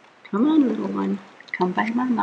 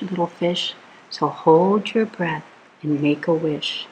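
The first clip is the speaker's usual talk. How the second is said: plain reading voice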